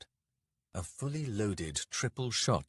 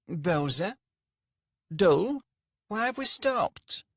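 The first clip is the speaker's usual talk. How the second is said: high, wavery, nasal merchant voice; a fussy rich man calling out, pitched high; pampered merchant calling for his servants, high and nasal; 11 semitones higher, 6 dB louder, slower than usual. A narrator's reading. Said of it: "Rika's voice was wavery, nasal, almost feminine".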